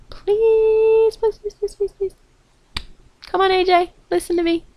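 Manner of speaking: high, whiny begging voice; pleading. Pretend. Fan begging AJ to listen, high pleading. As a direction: beg like a pleading child.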